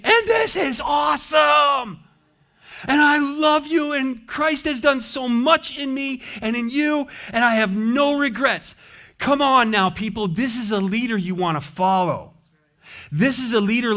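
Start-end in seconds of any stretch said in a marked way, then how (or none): 0.0-8.7 exultant shout. Acted. Preacher voicing a surrendered believer, exultant shout. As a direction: cry it out like a man overjoyed. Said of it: "and he's saying".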